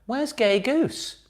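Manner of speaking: high, slow panto shout to the audience; panto call-out. Pretend. Host calling to listeners in panto mode, high and slow. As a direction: stretch it out like a pantomime dame calling the crowd. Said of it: "if we're in panto mode".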